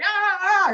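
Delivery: high mocking yelps from the smallest dude. Imitating the smallest dude at the gym, high yelps. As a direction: yelp it high like a show-off.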